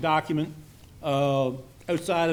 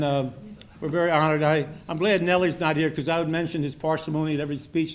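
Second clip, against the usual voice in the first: ordinary delivery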